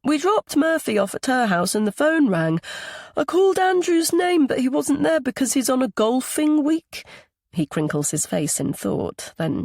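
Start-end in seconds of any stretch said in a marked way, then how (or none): 0.0-7.0 put-on high, chattering child's voice; little boy. A narrator's reading. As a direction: explain it like a small boy with news. Young boy explains to grandfather.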